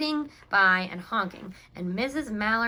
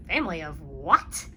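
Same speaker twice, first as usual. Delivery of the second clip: puzzled Clancy; 5 semitones lower, 4 dB louder than usual. A narrator's reading. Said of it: "Clancy said".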